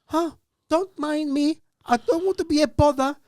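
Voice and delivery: high-pitched imitation of an old northern woman; stoic northern nana. Hurt old woman telling them to carry on, imitated northern stoicism. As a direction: put on a high, self-effacing nana voice. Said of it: "typical northern fashion, she goes".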